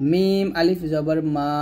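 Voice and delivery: slow spelling, মা held one long beat; dry lips together, then stretch মা; drawn-out madd. Shown as a demonstration. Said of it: "এক লিপ টান দিবেন".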